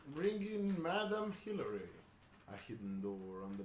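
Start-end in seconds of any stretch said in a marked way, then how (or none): 0.1-1.9 summoning call. Acted. Character summons the guest.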